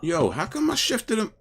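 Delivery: annoyed gamer griping loudly; frustrated complaint. Real, not acted. Frustrated at failed ability.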